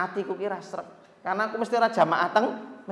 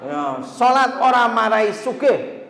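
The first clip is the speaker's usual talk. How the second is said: higher, louder and slow; emphatic quote; 6 semitones higher, 6 dB louder, slower than usual. Retold or imitated. Preacher recalling the boss's words, slow and loud. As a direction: drag out the boss's saying. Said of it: "kata si bos ini".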